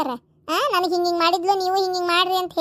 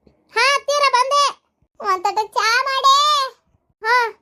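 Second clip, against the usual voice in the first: higher, slow called reply; calling out; 6 semitones higher, slower than usual. Acted. Daughter-in-law answering mother-in-law, slow higher call. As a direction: answer from another room, drawn out.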